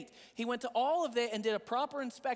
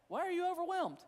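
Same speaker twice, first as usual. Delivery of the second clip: probing question; 7 semitones higher than usual. Retold or imitated. Self-questioning in dialogue.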